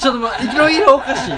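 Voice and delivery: 高く大きく笑い混じりに; 混乱して笑いながら止める; 笑いながらの制止. Retold or imitated. 混乱した自分の反応. (笑い混じりで慌てて止める声)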